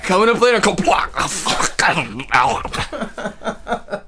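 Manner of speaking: slow, throaty mock Scottish promo; Scottish TV announcer. Scottish Playboy Channel promo, slow hammed-up accent. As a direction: drag the show title out in a joke brogue. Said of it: "Playboy Channel for fucking Scottish dudes".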